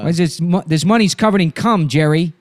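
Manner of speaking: Buscemi. Acted. Kidnapper questioning Jerry, agitated Buscemi impression. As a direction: demand an explanation as an exasperated crook. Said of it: "Why is he so fucking hard to do?".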